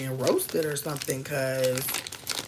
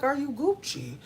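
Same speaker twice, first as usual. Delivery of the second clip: high check-in; 8 semitones higher than usual. Retold or imitated. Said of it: "be like".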